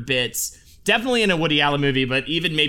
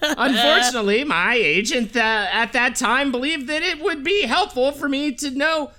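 higher, lightly nasal-free voice of a fussy actor; imitating a famous actor's voice, higher; Wallace Shawn impression; 10 semitones higher than usual. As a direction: read it as the actor himself, higher. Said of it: "I'll do a quote in his own voice".

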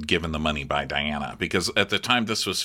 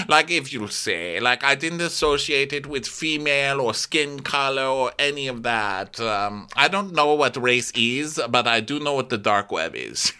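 halting, pitched-up diva impression; Diana; 7 semitones higher than usual. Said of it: "And she says".

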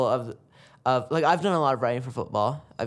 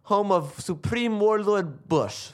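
higher, slow mock Borat accent; 7 semitones higher, slower than usual. Podcast host doing his Borat line, slow high Borat accent. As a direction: ham up Borat's Kazakh accent, dragging the words. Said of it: "Here's a good line that I can say".

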